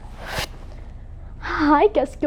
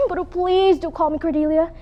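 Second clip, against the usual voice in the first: ordinary delivery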